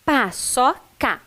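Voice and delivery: slow "Paçoca", cut into pa, ço, ca; syllable by syllable. Shown as a demonstration. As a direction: teach Paçoca one syllable at a time. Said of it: "C com A". (sílaba por sílaba, devagar)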